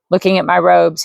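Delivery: ordinary delivery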